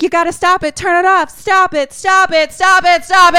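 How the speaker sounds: high plea building to a shout; hammed-up screaming climax; acted desperate scream.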